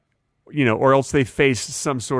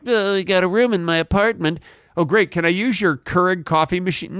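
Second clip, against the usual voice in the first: higher, whiny guest imitation; mock guest voice; 5 semitones higher than usual. Mocking Airbnb guest voice.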